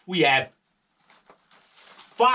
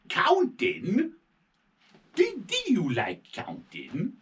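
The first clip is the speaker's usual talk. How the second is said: high surprised rising question; 9 semitones higher than usual. Acted. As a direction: pop up in surprise. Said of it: "surprised question to child".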